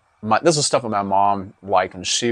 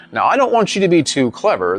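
high, loud stern judge; a touch of a stern judge warning; 7 semitones higher, 4 dB louder than usual. A narrator's reading. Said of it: "the judge warned him".

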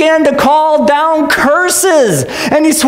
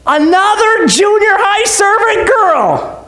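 high, drawn-out disbelief; hammed-up incredulous announcement; mock amazement; 8 semitones higher, slower than usual. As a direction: draw it out as if scandalized. Mock amazement at second girl.

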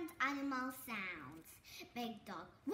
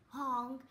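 honking goose call; 3 semitones lower, 3 dB louder than usual. Shown as a demonstration. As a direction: honk like a goose.

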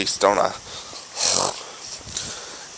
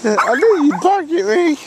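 squeaky high voice for the dog, with a laugh; high pet talk; 13 semitones higher than usual. Real, not acted. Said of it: "I don't talk like that normally".

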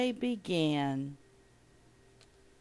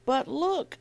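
higher-pitched but not truly shouted; understated storybook yell; mild mock yell; 8 semitones higher than usual. A narrator's reading. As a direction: call it out lightly, pitched up. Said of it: "I yelled".

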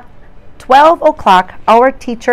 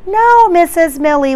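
higher, louder shout; 9 semitones higher, 5 dB louder than usual. Acted. Children shout back at the teacher, higher and louder. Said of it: "we shout".

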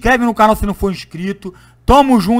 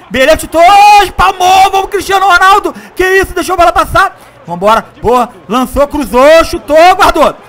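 lively football match commentary; play-by-play commentary; 4 semitones higher, 7 dB louder than usual. Acted. Live play-by-play of own match.

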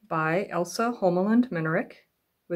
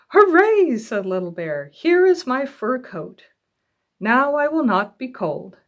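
excited cheer; 5 semitones higher, 7 dB louder than usual. Acted. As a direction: cheer like a happy cub.

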